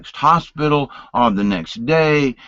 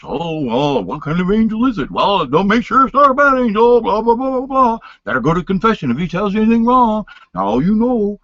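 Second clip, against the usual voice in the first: higher, louder, fast preachy mimicry; mocking Catholics; 10 semitones higher, 3 dB louder than usual. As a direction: mimic nagging church people. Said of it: "You know, the Catholics".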